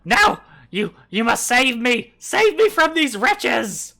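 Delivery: very high, loud desperate goblin begging; pleading goblin. Acted. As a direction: shriek for rescue like your life depends on it. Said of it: "the Glom is just like".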